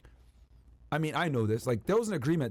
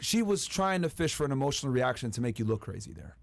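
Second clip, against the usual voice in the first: ordinary delivery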